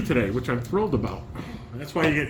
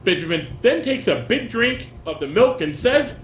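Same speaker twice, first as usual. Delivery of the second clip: reading stage directions; 7 semitones higher, 5 dB louder, slower than usual. Acted.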